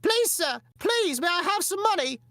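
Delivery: very high, loud mock British urchin; begging child. Little children asking for money, high mock British pleading. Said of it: "little children asking for money".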